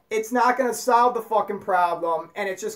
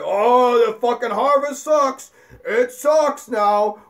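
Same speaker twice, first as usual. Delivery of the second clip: loud, hammed-up griping; mock complaining; 4 dB louder, slower than usual. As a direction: moan about it, dragging the last words. Voices someone griping about bad harvest.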